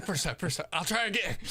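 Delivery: laughing. Real, not acted. Laughing echo of friend.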